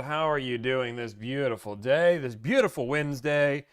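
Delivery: normal talking voice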